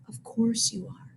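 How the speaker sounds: very soft and gentle; calm the upset boy down; teacher soothing a crying student. A narrator's reading. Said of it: "soothed Mister Jupiter".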